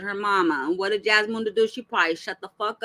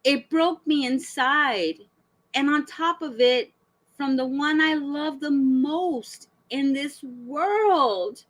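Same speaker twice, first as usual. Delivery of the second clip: slow, overdramatic lament; mock heartbroken; 3 semitones higher, slower than usual. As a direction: read her post out with drawn-out mock anguish. Mocking reading of her dramatic post.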